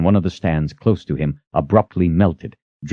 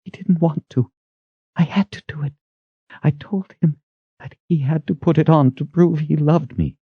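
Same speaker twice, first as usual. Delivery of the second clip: higher, subdued confessing voice; quivering confession; 10 semitones higher than usual. A narrator's reading. Kalen quivering before Zed, confessing fearfully. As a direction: confess haltingly, pitched up.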